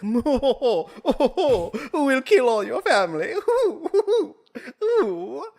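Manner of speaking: high, giggling hammed-up British accent; mock British voice. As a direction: play a cartoon British villain, laughing high. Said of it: "that's how British people talk".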